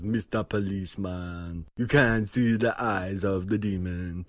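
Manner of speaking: laid-on-thick Jamaican accent imitation. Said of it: "impressed by your Jamaican accent".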